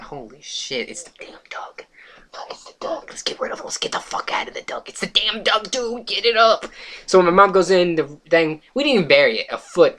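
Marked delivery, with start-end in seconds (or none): 0.0-4.9 hushed, urgent acted whisper; panicked whisper. Acts out boys finding the stinking duck. 4.9-6.7 high, strained, acted urgency; urgent alarm. Same boys, urgency breaking out of whisper.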